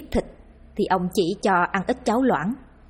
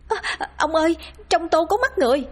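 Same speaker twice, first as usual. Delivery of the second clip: very high, horrified cry; terrified scream; 9 semitones higher than usual. A narrator's reading. Wife finding human eyes in soup, terrified scream. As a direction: shriek to the husband in terror. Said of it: "hét lên".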